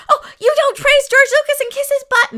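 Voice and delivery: high, loud whiny mockery through laughter; mocking fan. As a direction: parody a whiny fanboy, laughing. Mocking toxic fans.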